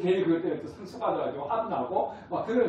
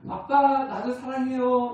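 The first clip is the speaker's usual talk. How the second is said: put-on sweet little child; 6 semitones higher, 3 dB louder, slower than usual. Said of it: "아이들 반응이 어땠어요".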